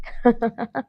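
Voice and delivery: light acted giggle; laugh. A narrator's reading. Basant amused by her sleepy friend's question, light laugh. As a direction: giggle before answering. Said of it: "بسنت بضحك".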